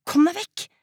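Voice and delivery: high-pitched order to leave; angry dismissal. Acted. Daughter to clinging mother, get away, angry and high. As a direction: drive them off, pitch jumping up.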